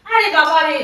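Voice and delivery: loud, exasperated groan of words; fed up and loud; exasperated outburst. Pretend. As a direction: burst out at the interruption. Exasperated at husband interrupting her call.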